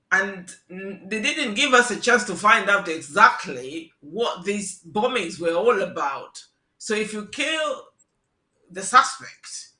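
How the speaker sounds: ordinary talk, nothing marked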